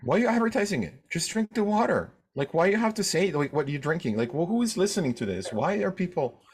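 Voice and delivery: high-pitched, exasperated complaint. Acted. Sibling snaps at needless announcement.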